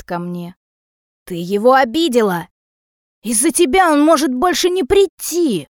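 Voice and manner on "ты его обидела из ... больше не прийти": loud accusation climbing high; angry blaming. A narrator's reading. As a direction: shout blame, building in pitch. Mother shouts blame at daughter.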